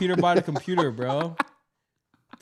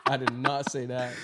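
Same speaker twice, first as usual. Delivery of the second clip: quieter laughing denial; 4 semitones lower, 6 dB quieter, slower than usual. Real, not acted. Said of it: "Honk said he a Niger boy".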